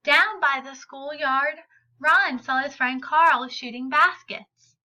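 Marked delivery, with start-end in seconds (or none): none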